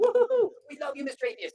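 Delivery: loud, high crowd cheer; loud playful whooping. Retold or imitated. Students cheering the speaker.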